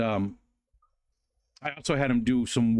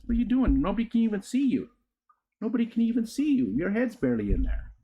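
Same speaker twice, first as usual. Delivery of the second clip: high singsong pet-owner voice; high-pitched doggy-talk; 11 semitones higher than usual. Real, not acted. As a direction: coax the dog up in high, playful pitch. Coaxing the dog over.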